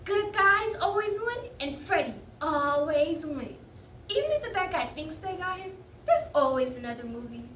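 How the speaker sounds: put-on high, loud little-kid declaration; seven-year-old brother. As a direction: argue it like an emphatic small child. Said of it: "as my brother likes to put it".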